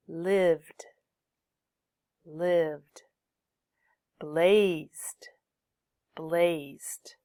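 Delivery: isolated words from "Trailed" to "rescued", each closing on a voiced D; final D sound. Shown as a demonstration. Teacher drills examples for students, "Trailed" to "rescued" ending in D. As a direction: drill "Opened", "Lived", "Stayed" carefully with D endings and no extra syllable. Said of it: "Use the D sound after voiced final sounds".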